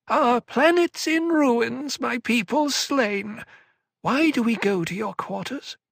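high, slow, pleading little whine; whining elfin voice. A narrator's reading. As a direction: beg like a wheedling elf. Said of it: "in that whining elfin voice".

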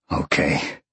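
reluctant, understated low growl. A narrator's reading. Sean giving in before hanging up, lightly growled. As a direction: give in with a restrained grumble. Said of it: "Sean growled and hung up".

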